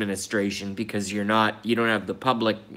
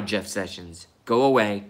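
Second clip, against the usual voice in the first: ordinary talk, nothing marked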